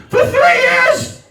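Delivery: high, drawn-out yell; exasperated outburst. Retold or imitated. Husband raging at three years of this, exasperated. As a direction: stretch it into an indignant high shout. Ranting about wife's achievement.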